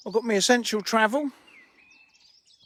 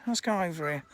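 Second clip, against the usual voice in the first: ordinary delivery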